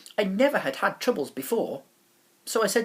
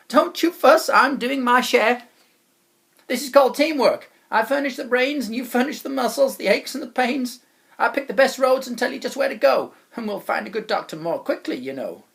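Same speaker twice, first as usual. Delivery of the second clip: loud, higher, self-satisfied voice; bossy wobble chap; 8 semitones higher, 9 dB louder than usual. Acted. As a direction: boss him around cheerfully. Chap bossing boy.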